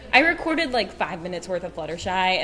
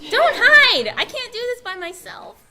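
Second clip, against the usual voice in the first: much higher and louder pleading; loud playful plea; 9 dB louder than usual. Real, not acted. Panelist begging her co-host to come out, high and loud. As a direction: beg her not to hide.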